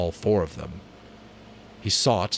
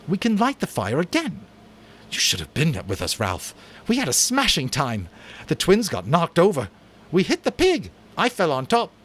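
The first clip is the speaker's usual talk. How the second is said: high, loud, eager bragging; excited boasting; 10 semitones higher, 5 dB louder than usual. Acted. Jack brags to Ralph about the hunt, eager. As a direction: sweep past the blame with glee.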